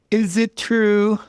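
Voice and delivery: high, loud, slow tearful question; tearful demand. Acted. Wife confronting her fiancé, tearful loud demand. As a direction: demand it through tears. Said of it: "I demanded, tears streaming down my face".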